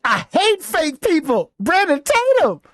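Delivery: hammed-up imitation, pitched well up; mocking mimicry. Mimics the clip just played.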